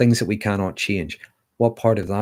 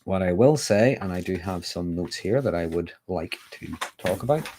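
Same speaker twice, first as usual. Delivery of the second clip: ordinary talk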